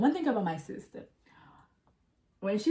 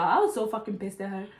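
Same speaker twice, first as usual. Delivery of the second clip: normal talking voice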